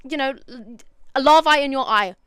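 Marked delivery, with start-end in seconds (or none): 1.2-2.2 horrified. Real, not acted. Horrified about moth larvae.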